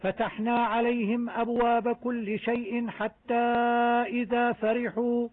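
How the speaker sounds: slow chanted recitation, the mim sounds clear; Quran recitation. Shown as a demonstration. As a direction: recite the verse, marking each mim. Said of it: "أحكام الميم الساكنة في آية واحدة". (تلاوة مجودة توضح أحكام الميم)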